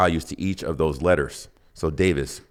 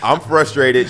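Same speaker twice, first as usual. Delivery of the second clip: ordinary talk, nothing marked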